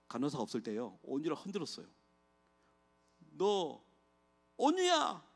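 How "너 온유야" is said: high, pleading call to his daughter; father pleading at his daughter's bedside; desperate plea. Retold or imitated. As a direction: beg her to wake, voice straining high. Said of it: "그랬어요, 제가요".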